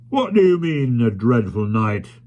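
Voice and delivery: put-on loud blustery old sailor; gruff admiral. A narrator's reading. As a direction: bark it like a jolly admiral. Admiral Boom scoffing.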